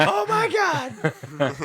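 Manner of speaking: high, loud, drawn-out mock scream; mock horror. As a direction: wail it slowly in hammed-up shock. Mock horror at George being dead.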